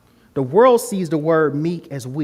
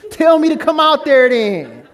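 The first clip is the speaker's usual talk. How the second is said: high, loud mock yell; big-mouthed Peter; 11 semitones higher, 10 dB louder than usual. Peter yells to Jesus from the boat, brashly. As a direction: bellow the dare like a loudmouth. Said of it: "Peter got a big mouth".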